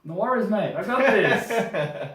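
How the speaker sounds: high, loud, hammed-up reassurance; breezy Aussie bravado. Co-host answers the handover with hammed-up, laughing confidence. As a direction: play up the easygoing mate.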